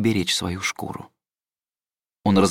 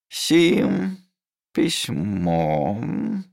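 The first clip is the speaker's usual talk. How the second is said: dictating slowly; much slower than usual. Acted. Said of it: "начал диктовать себе лазурит".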